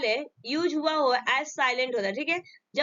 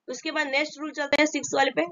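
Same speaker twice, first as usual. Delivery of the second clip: ordinary talk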